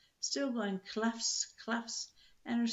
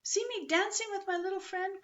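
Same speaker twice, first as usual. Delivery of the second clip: high, gleeful call; gleeful cry; 9 semitones higher than usual. A narrator's reading. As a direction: crow with delight while riding. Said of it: "cried Pippi".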